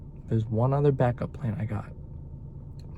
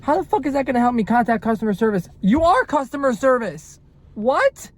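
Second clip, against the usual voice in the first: angry rant; 10 semitones higher, 6 dB louder than usual. Real, not acted. Ranting at the phone call.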